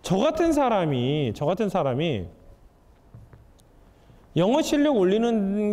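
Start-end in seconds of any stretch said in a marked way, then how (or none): none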